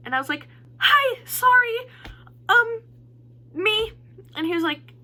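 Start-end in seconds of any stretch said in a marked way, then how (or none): 0.8-3.9 high, loud, drawn-out nervous greeting; flustered fan. Retold or imitated. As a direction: squeak it out, flustered and apologetic. Flustered fan answering her idol.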